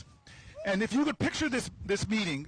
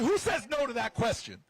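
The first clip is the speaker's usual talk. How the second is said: usual speaking voice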